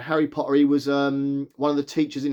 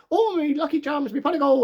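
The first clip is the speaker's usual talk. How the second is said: hammed-up stage Irish accent, pitched much higher; mock leprechaun Irish accent, overdone on purpose; 11 semitones higher than usual. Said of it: "the most stereotypical Irishness you could ever get".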